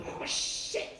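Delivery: whispered, shared exclamation; hushed dismay. Retold or imitated. Two tall women seeing short star, whispered dismay. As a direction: let it out as a stunned whisper. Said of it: "we both went".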